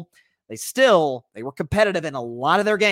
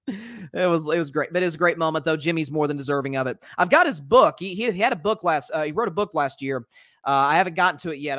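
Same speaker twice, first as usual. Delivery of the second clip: ordinary delivery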